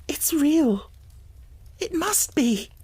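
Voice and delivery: very high, trembling wonder; pleading it to be true; tearful joy. A narrator's reading. Girl begging the dream to be real, very high.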